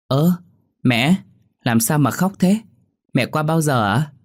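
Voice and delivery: put-on high-pitched, puzzled child voice; surprised little girl. A narrator's reading. Daughter finds her mother crying, surprised. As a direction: ask like a startled small daughter. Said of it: "đôi mắt đầy ngạc nhiên".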